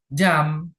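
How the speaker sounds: normal talking voice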